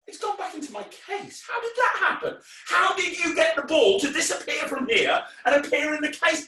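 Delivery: high-pitched, incredulous squeal; hammed-up disbelief, way up high; mock bewilderment. Feigning bafflement at the trick.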